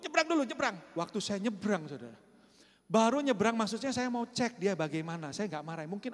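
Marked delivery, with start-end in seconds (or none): 0.0-0.8 raised call to cross now; loud call to cross. Retold or imitated. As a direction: call across the road to hurry. Guard calling out at crossing.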